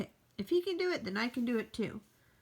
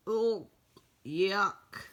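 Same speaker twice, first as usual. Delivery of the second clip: usual speaking voice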